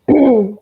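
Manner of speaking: a rough cough to clear the throat. Real, not acted.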